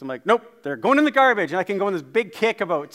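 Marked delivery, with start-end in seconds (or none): 0.3-1.5 firm dad refusal. Retold or imitated. Dad refusing kids' cereal.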